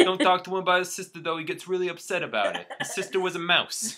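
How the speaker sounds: high, loud cartoon Brooklyn accent. Rat warning about his friend's sister, mock Brooklyn accent. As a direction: confide loudly in an exaggerated Brooklyn accent. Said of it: "If a Brooklyn".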